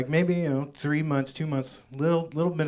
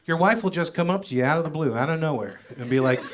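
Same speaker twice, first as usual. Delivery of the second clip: ordinary delivery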